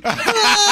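a high, loud laugh that breaks out; loud burst of laughter. Real, not acted. Stuck on the rock, laughing at the rescuer's idea, loud high burst of laughter.

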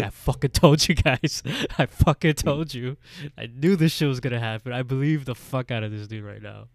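loud, hammed-up angry yelling; vindicated outburst. [name] rounds on the disbelieving crew, hammed-up furious outburst.

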